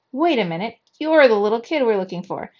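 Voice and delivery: loud, startled recognition; gasped realization. A narrator's reading. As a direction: burst out as it dawns on you. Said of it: "he gasped".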